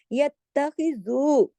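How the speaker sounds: यत्तखिज़ू slowly with ज़ू drawn out; two-count stretch. Shown as a demonstration. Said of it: "जू दो हरकत खींचेगा". (यत्तखिज़ू, जू खींचकर)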